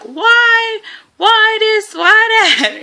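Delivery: imitating herself yelling at nurses; high mock yell. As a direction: squeal out each why in mock outrage. Retelling cussing everybody out.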